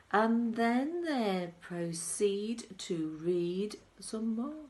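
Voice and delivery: bouncy chant with the beat; rhythmic poem recitation. A narrator's reading. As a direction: recite it like a lively rhyme. Reciting the Oompa Loompa song.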